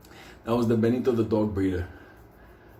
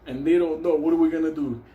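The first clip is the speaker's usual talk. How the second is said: normal talking voice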